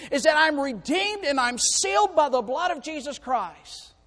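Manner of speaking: loud, high, urgent preaching; fiery preaching. Acted. Preacher urging the lost not to delay, shouting. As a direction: drive it home from the pulpit.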